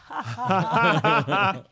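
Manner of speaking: huge imitated crowd guffaw; very loud mock belly laugh, "Ah-ha-ha"; crowd roaring with laughter. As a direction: roar like an audience dying laughing. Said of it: "every time the crowd would be like".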